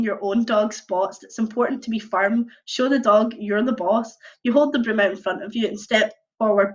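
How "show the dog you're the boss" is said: plain reading voice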